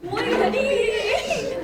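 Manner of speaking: high, slow laughing question. Real, not acted. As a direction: stretch it out through laughter.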